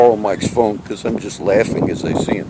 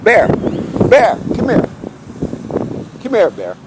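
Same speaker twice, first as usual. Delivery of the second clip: calling the dog; 10 semitones higher than usual. Real, not acted. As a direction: call out sharply for a dog that won't come.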